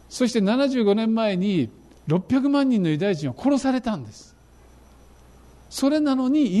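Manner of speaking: 高い声で力を込めて訴える説教; 驚くべき事実を会衆に高い調子で訴えかける; 熱のこもった説教. Real, not acted. (熱く訴える説教口調)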